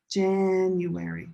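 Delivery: "January" slowly, with JAN drawn out; first-syllable stress. Shown as a demonstration. Teacher showing where the stress is, "January" as slow JAAN-uary. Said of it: "Right there".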